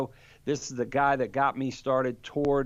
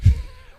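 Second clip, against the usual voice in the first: sudden breathy laugh; 12 dB louder than usual. Real, not acted. Laughing at own defeat.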